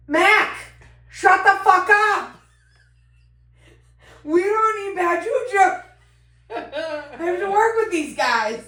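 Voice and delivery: high, loud mock scold with laughter; half-laughing hush at her friend. Telling Mac to shut up at the scene, loud mock scolding. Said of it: "And I'm like".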